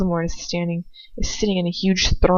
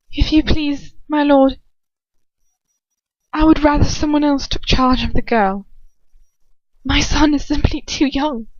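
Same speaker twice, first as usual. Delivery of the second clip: higher, louder cold and calm mother; Narcissa's cold calm voice; 8 semitones higher, 5 dB louder than usual. Acted. Narcissa asking Voldemort to spare her son, cold and calm. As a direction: plead coldly and calmly for her son. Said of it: "cold voice, clear and calm".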